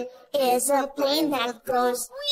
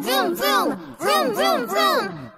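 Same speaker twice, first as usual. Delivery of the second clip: shouted car vroom; 9 semitones higher than usual. Pretend. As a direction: roar vroom like a race car. Car engine noise shouted.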